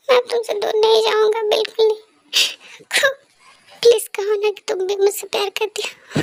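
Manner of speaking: ordinary talk, nothing marked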